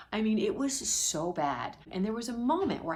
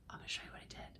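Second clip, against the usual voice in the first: whisper; 17 dB quieter, much faster than usual. Real, not acted. Secretive aside to viewers.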